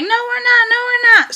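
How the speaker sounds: lightly voiced nurses reassuring; high mock nurse voice. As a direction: chirp it like cheery nurses. Imitating nurses' reply.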